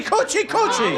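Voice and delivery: high, playful coochie-coochie catchphrase with a laugh. Retold or imitated. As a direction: tease by squealing it way up high.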